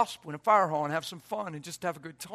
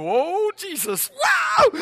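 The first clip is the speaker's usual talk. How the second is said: much higher, slow, rising to a loud shout; shocked cry; 14 semitones higher, 6 dB louder, much slower than usual. Retold or imitated. Driver hit at the intersection, shocked cry. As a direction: react as the car slams into you. Car crash moment.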